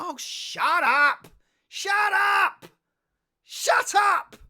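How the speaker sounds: high, drawn-out mock yelling; exasperated shouting. Streamer silencing a critic, exasperated mock shouting. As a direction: build to a high yell, each repeat fed up.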